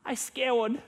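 put-on high toddler voice, mispronouncing scared; scared little three-year-old. Feverish three-year-old telling dad, imitated high toddler voice. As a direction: imitate a small child who can't say the r. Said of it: "they can't quite say it right".

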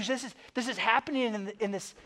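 ordinary talk

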